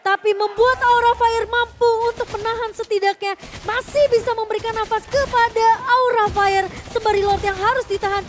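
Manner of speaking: loud, fast hype esports call; excited play-by-play. Real, not acted. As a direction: call the teamfight at full hype.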